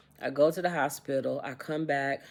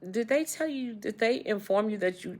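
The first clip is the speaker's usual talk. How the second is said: doctor's words pitched higher; a lightly voiced doctor breaking the news; retold doctor; 8 semitones higher than usual. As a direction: quote the doctor urgently, voice up high. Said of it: "He said".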